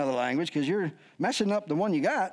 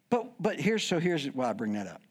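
ordinary delivery

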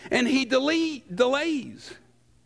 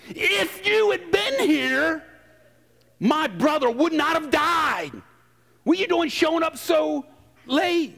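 a little sass; 6 semitones higher than usual. Retold or imitated. Martha reproaching Jesus for arriving late, sassy. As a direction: let the blame bite, a touch of sass. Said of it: "I imagine it was a little sass".

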